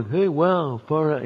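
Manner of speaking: lightly voiced stoned hippie; a hint of dazed stoner talk; spaced-out hippie. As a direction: play it mildly spaced out. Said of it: "you know, space out".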